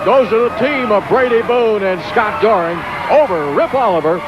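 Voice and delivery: high, fast ringside call of the match; excited play-by-play. Real, not acted. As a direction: call every move like a ringside announcer.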